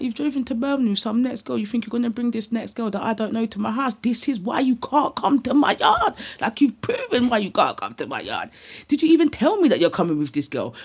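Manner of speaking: high, loud, exasperated rant; indignant telling-off. Retold or imitated. Confronting a visitor who brought a stranger, indignant. Retold confrontation over unexpected guest.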